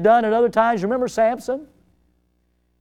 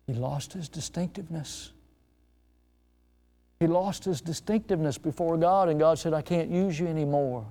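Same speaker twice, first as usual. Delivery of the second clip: very soft and low, grave; hushed solemnity; 3 semitones lower, 9 dB quieter than usual. Real, not acted. Preacher telling how Samson lost his distinctiveness, hushed and solemn. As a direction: drop right down, almost to a murmur.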